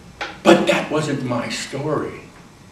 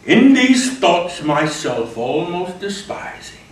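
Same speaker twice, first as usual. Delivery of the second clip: sonnet recitation; 3 semitones higher, 5 dB louder than usual. Shown as a demonstration. Actor reciting a sonnet to an audience, building. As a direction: recite the sonnet with rising passion.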